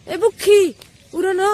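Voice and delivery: loud call across the yard. Real, not acted. Calling out to someone. (ज़ोर से पुकारना)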